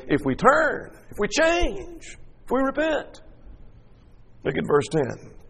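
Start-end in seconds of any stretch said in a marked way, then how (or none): 0.1-3.2 much higher and softer, drawn out; the king pleading that God may relent, high and soft; hopeful plea. Acted. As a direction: float it up softly, daring to hope. King's hopeful plea, voiced.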